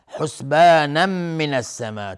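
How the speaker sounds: loud حسبانا من السماء, a nasal m linking the first two words; merging with ghunna. Shown as a demonstration. Teacher demonstrating idgham, حسبانا من joined by a nasal m. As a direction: merge the end of حسبانا into من with a held hum. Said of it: "تنوین بعدش میم ادغام با غنه".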